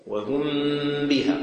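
"وهم بها" with the meem of "وهم" held in a hum before the ba; إخفاء الميم. Shown as a demonstration. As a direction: linger on a hidden meem in "وهم", then release "بها". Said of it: "هذا إخفاء". ("وهم بها" بإخفاء الميم مع غنة ممدودة)

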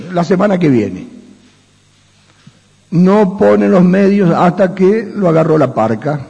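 usual speaking voice

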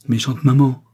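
put-on a lightly tearful, accusing child; tearful little boy. A narrator's reading. Little boy scolding his mother, mildly tearful. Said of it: "dit le petit garçon en pleurant".

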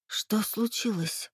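soft, slow, faltering question; timid babble. Acted. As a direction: barely get the words out. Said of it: "пролепетала я".

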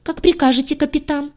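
high, timid and automatic reply; obey meekly, voice up high; frightened squeak. Acted. Redhead answers the captain, high and frightened. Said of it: "испуганно пискнула она".

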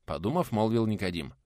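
ordinary delivery, nothing marked

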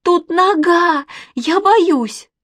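high, loud, drawn-out panic; loud high cry of fear; frightened wail. A narrator's reading. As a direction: wail it like a scared little girl. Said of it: "завопила Манечка".

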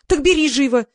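high, loud, urgent order; bossy command. A narrator's reading. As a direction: order them to hurry. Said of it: "велела Лизавета".

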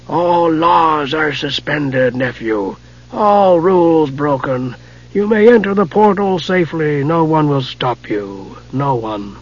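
slow, soft, ominous wizard; soft rasping wizard. Acted. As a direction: murmur it slowly, full of menace. Raistlin's voice in the story.

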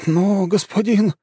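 high, slow, flustered protest. A narrator's reading. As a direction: draw it out high, thrown off balance. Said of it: "растерялся тот". (растерянно)

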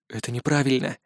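soft, low breathy whisper. A narrator's reading. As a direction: whisper it under your breath. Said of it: "прошептал я".

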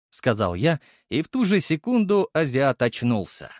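ordinary delivery

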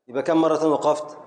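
ordinary delivery

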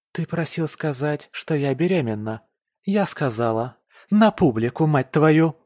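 wife flaring up at her husband. Acted. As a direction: explode with rage at the end. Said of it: "Снова взвивается Ника".

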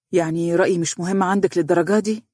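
ordinary delivery

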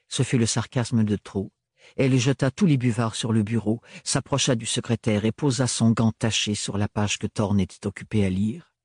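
ordinary delivery, nothing marked

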